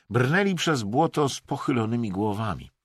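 usual speaking voice

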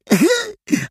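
krótki, wysoki śmiech; wysoki rechot. A narrator's reading. Śmiech Adasia. (krótki wysoki śmiech)

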